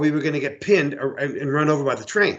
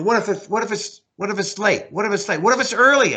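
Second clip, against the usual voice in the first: mocking, much higher, whining repeat; mocking his partner; 8 semitones higher than usual. Teller mocking his partner Ron after the train passed, high mocking whine. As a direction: parrot the partner's excuse back at him, high and needling. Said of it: "saying, you know".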